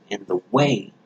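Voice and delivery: ordinary talk, nothing marked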